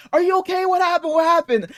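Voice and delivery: high-pitched imitation of anxious questioning; worried partner. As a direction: mimic her alarmed, repeated questions. Voicing partner calling back.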